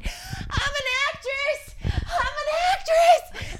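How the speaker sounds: very high, drawn-out squeal; re-enacted ecstatic screech; jumping for joy. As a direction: squeal it slowly in mock elation. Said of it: "I'm jumping up and down".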